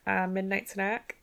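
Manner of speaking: slow, unsure rising 'Uh, midnight snack?'; mock awkward midnight-snack question; sheepish question. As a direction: play it like a caught-out excuse. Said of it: "And she's like".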